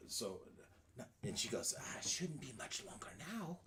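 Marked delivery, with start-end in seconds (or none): 1.8-3.6 raspy hag whisper; old hag. Acted. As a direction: whisper like a crone. Hag speaks again.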